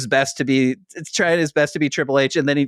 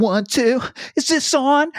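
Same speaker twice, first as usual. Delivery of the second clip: high, whiny mic check; whiny singer voice; 14 semitones higher than usual. Retold or imitated. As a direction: whine it like a rapper on stage. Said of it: "he's got that whiny voice".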